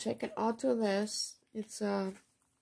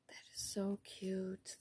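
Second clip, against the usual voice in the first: whispered aside of delight; a hushed, admiring whisper; soft whisper; 2 semitones lower, 9 dB quieter than usual. Real, not acted. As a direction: breathe it out, barely voiced. Admiring a handmade card.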